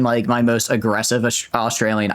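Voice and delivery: normal talking voice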